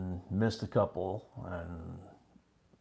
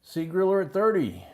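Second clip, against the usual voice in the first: high, loud bid call; auctioneer's call; 8 semitones higher, 7 dB louder than usual. Real, not acted. Auctioneer calling the current bid.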